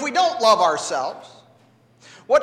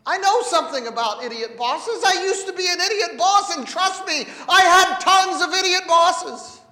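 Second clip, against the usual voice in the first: normal talking voice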